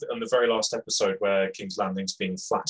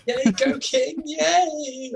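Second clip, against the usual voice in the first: very high mock cheer; unauthoritative cheer; 4 dB louder, much slower than usual. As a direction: cheer in a silly, squeaky put-on voice. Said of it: "you are not authoritative at all".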